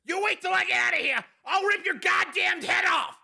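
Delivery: very high, strained shouting; furious threat. A narrator's reading. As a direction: roar a threat while straining against restraints. Restrained prisoner threatening the guard.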